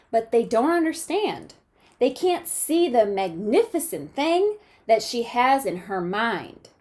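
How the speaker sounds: expressive picture-book read-aloud; reading to children. A narrator's reading. Teacher reading a storybook to her class, lively and expressive.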